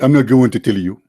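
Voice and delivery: mocking imitation. Mocks opponent's earlier evasive answer.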